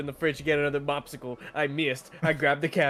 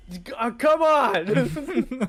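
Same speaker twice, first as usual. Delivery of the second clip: exasperated; 8 semitones higher, 5 dB louder, much slower than usual. Retold or imitated. Frustrated at the clerk.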